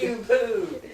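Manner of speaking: high, loud, breaking into laughter; laughing punchline. Retold or imitated. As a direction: blurt the punchline while cracking up. Repeats punchline amid laughter.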